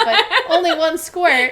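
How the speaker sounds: slow, loud confession through laughter; laughing. Real, not acted. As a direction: laugh the words out loudly. Laughing while confessing an accident.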